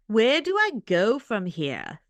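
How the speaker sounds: second go at the Kiwi line; better Kiwi attempt. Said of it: "you're getting better".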